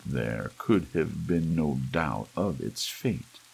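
plain reading voice

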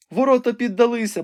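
high-pitched frightened maiden at the window; young woman Rebecca, anxious. A narrator's reading. Rebecca tells the wounded knight what she sees, high and anxious.

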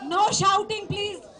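loud plea to the media. Real, not acted. Calling the crowd to order.

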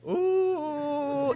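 long, high "oooh"; crowd's taunting ooh. Acted. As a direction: imitate the crowd's rising excitement. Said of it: "Everybody say".

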